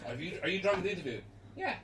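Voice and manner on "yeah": squeaky high, cocky retort; defiant. Retold or imitated. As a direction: snap back high and cheeky. Drunk interviewee retort.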